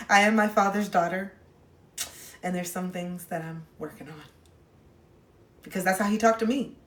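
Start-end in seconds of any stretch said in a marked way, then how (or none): none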